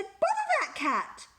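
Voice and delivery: fed-up mum snapping at the cat; cross mum voice. A narrator's reading. Mum scolding the cat, high and exasperated. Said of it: "Missus Thomas said".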